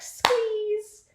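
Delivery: high, drawn-out squeal; playful squeezing effect. Retold or imitated. Acting out crushing the heart.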